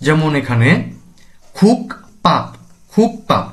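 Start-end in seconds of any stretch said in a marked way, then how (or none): 1.6-3.5 খুক পাপ with a hard p, not b; p for biup. Shown as a demonstration. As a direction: say খুক পাপ as separated syllables, tense p. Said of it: "বিয়োগটি হবে প এর মতো".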